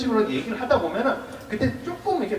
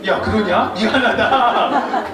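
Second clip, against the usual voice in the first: loud, drawn-out 미안하다; hammed-up friendly sorry, stretched out; friend's apology; 3 semitones higher, 6 dB louder, much slower than usual. Monk plays the friend replying to the hurt, loud hammed-up apology. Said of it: "그렇게 나올 거 아니에요".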